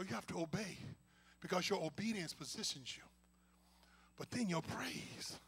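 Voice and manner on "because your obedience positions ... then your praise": hushed preacher whisper; whispered, slowing sermon line. Real, not acted. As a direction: lean in and whisper the point. Preacher drops into hushed emphasis.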